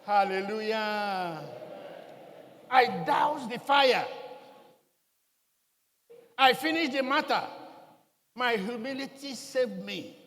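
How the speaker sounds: slow, loud preacher's proclamation; triumphant sermon shout; full-force preaching. Acted. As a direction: thunder it out to the church. Preaching climax.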